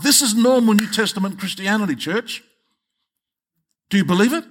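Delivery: high-pitched, insistent exhortation; impassioned preaching. Real, not acted. Preacher urging the congregation to believe, high and impassioned.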